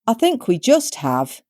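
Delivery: put-on dry old crone. A narrator's reading.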